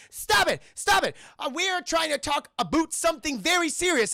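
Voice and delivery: high, loud shouting quote; hammed-up angry yell; mock furious shout. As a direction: yell it like an outraged cartoon Canadian. Streamer voicing furious Phillip.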